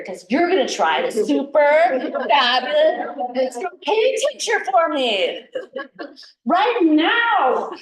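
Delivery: high, loud and slow, hammed-up like an infomercial; excited sales pitch. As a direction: drag out super fabulous as if selling a miracle product. Excitedly pitching her tincture.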